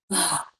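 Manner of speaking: big sigh. Shown as a demonstration. Showing listeners the sigh not to do, exaggerated sigh. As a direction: let out a big, showy sigh. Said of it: "do the big sigh like".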